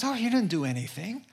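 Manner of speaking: high, quick little reply; tearful wife. Retold or imitated. Crying wife reassuring her husband, high and quick. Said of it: "she started crying".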